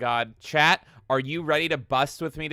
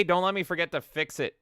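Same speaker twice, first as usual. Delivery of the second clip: usual speaking voice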